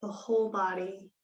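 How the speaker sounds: slow, measured yoga nidra guidance with long pauses; guided meditation. Real, not acted. Yoga teacher settling students into Shavasana, slow calm meditation voice. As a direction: ease them into stillness, unhurried and calm.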